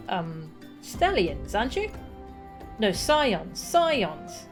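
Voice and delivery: put-on bouncy child voice with swooping pitch; inquisitive child. Kaharu found hiding by a stranger, inquisitive child. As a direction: play a chatty, curious kid.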